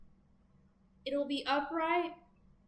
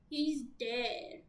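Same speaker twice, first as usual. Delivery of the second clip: normal talking voice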